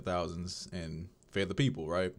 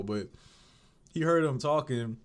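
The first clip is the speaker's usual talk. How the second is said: usual speaking voice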